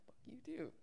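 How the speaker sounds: whispered retold insult; barely voiced parting jab; muttered parting curse. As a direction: curse under your breath, imitating him.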